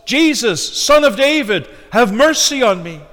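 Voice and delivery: high, loud imitated plea; preacher voicing a desperate cry for mercy. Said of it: "cried out at the top of his lungs".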